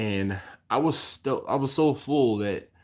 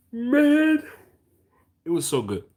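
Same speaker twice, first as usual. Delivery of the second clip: excited; 14 semitones higher, 7 dB louder than usual. Real, not acted. Raving about the brisket taco.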